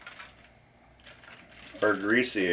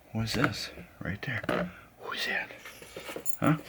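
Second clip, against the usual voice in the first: softer coaxing, last line whispered; soft talk to dog; 2 semitones higher, 9 dB quieter than usual. Real, not acted. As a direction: quietly coax the dog.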